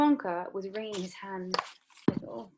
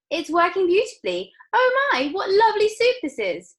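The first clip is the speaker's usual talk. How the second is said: very high, loud rapture; thrilled girl; 13 semitones higher, 10 dB louder than usual. Acted. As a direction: swoon over the flavour. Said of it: "said Violet".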